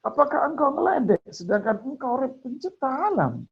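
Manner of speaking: high, disbelieving "are you teasing me"; incredulous plea. Acted. Man at heaven's gate protesting to God, high and disbelieving. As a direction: lift the voice high in amazed protest.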